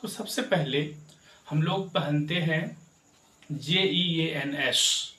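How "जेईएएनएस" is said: slow, each letter spelled out. Shown as a demonstration. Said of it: "इसे क्या पढ़ते हैं?".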